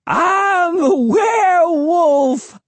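very high, long-drawn crazed wail; crying out. A narrator's reading. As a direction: wail it wildly, stretching every word. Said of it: "he cried".

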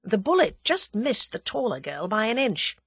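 usual speaking voice

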